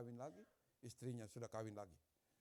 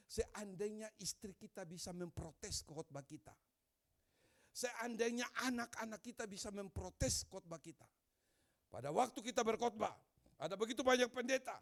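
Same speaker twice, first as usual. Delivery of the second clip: much louder and higher, rising to a shout; preaching build-up; 9 semitones higher, 10 dB louder than usual. Retold or imitated. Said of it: "Lalu dia katakan ini". (berkhotbah makin keras sampai berteriak)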